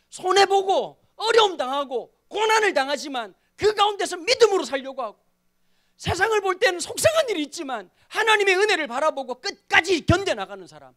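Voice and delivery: loud, high, driving sermon delivery; impassioned preaching. Real, not acted. Pastor urging the congregation to endure in faith, impassioned. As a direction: preach it out forcefully, pushing the voice up.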